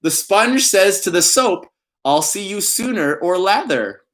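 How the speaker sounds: pun reading voice. Pretend. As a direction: deliver it like a proverb. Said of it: "I always put on that voice when I'm reading puns".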